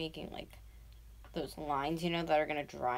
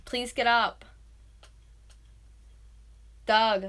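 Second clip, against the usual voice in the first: loud, raised-pitch plea; calling out; 4 semitones higher, 11 dB louder, slower than usual. Real, not acted. Begging boyfriend to get up, calling out. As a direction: call insistently to a sleeper.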